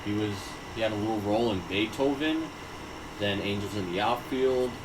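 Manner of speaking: normal talking voice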